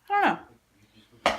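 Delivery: high, slow sing-song; sing-song I don't know. Real, not acted. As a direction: sing it like a shrug.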